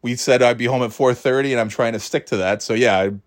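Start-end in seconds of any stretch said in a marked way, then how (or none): none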